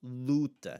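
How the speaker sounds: Luta with a long, loud 'oo'; open U sound. Shown as a demonstration. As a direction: model 'Luta' as LOO-ta. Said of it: "gonna have the open sound".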